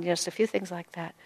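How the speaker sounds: ordinary delivery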